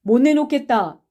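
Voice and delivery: abrupt defiant answer; curt refusal. A narrator's reading. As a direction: refuse flatly in one blow. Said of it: "갑자기 한마디로 딱 끊어서".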